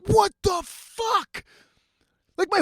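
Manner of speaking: high, slow, drawn-out disbelief; incredulous outrage. Retold or imitated. Host discovering muddy socks in his bed, slow incredulous outrage. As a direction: stretch every word in high-pitched outrage.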